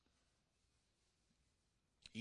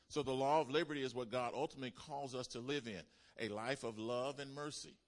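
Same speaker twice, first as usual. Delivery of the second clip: loud, fast, driving sermon delivery; full-force preaching; 3 semitones higher, 8 dB louder than usual. Real, not acted. Preacher urging the church toward mercy, loud driving preaching. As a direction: push the message hard to the pews.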